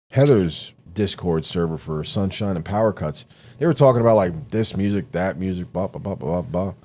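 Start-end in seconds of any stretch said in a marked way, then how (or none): none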